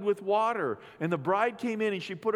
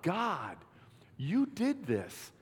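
hushed awe; 4 dB quieter, much slower than usual. Retold or imitated. Awed prayer at the deer.

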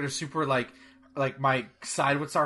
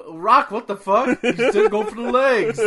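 loud, higher voice, laughing through it; laughing mock scold; 7 semitones higher, 7 dB louder than usual. Coach scolding Rocky for skipping the legs, laughing loudly. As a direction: scold Rocky while cracking up. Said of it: "just like".

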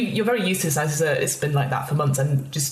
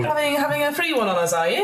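lightly higher teasing barman; a hint of the sly barman; 9 semitones higher than usual. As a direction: needle him about drinking for free. Bar owner teasing Lawrence.

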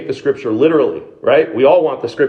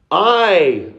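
"I" stretched out with a high, falling tune; stress on I; 4 semitones higher than usual. Shown as a demonstration. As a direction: hold "I" high and let it fall. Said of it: "look at the inflection here".

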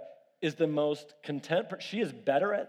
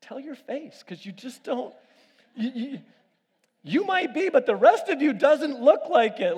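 teasing retort; 9 semitones higher, 6 dB louder than usual. Retold or imitated. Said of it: "And you're like".

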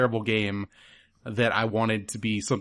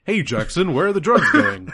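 loud, high hammed-up shout; Batman interrogation shout; 8 semitones higher, 6 dB louder than usual. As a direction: bark the question like Batman. Batman interrogating, mock cop.

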